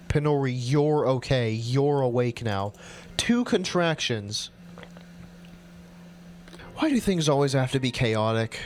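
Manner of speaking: plain reading voice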